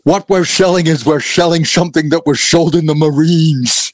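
loud, strained Jesse Ventura growl; Jesse Ventura impression. Guest imitating Jesse Ventura for the hosts, loud and strained. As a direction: bellow it like the ex-wrestler governor. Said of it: "I'm gonna blow my voice out doing Jesse's".

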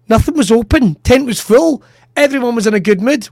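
usual speaking voice

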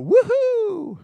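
put-on high, excited little-kid woohoo; squealed kid's woohoo; child's woohoo. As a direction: cheer like a thrilled small boy. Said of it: "he would literally woohoo".

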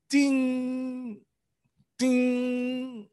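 high, ringing sustained "ding"; long, pitched bell imitation; imitated timer chime. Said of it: "timer went off, like".

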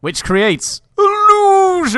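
high, loud, stretched-out mock reveal; theatrical announcement. Host caps the lights joke, high and theatrical. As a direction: swell grandly and slowly into the pun. Reprises grand illusion gag.

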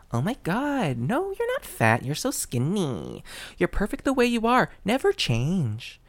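high, syrupy gush of fake comfort; hammed-up sugary flattery; gushing fake reassurance. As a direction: coo at them like a lying friend. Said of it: "they say to you".

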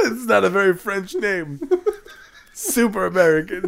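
laughing while talking. Real, not acted. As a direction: giggle it out. Laughing at donkey name.

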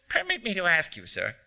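lightly squeaked, courteous little man; a hint of squeak, formally polite; squeaky voice. A narrator's reading. Stranger politely questioning a bus passenger, in a lightly squeaky voice. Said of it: "he said in a squeaky voice".

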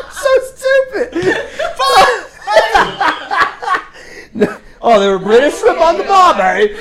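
high, loud helpless laughter through the words; laughing hard. Real, not acted. Host losing it over the British shrimp mix-up, laughing hard. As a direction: crack up, barely getting the words out.

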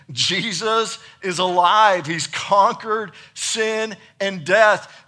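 loud, high, drawn-out exultation; excited proclamation. Real, not acted. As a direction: proclaim it big, stretching the key words. Preacher enacting the women's excitement.

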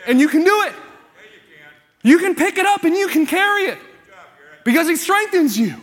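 rousing encouragement. Real, not acted. Preacher urging the congregation on.

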